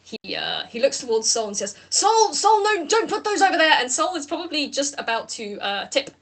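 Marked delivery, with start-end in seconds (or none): 1.9-3.7 high, loud call to Sol. Acted. Lau calling to Sol to stop the bucket, high and loud. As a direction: call across the room to stop him.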